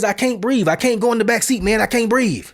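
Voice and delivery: high, insistent mimicked plea; imitating agitated suspect. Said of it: "he's getting agitated".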